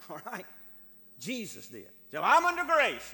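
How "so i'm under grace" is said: loud, high-pitched mock protest; protesting objector. Believer excusing himself from the commandments, loud high protest.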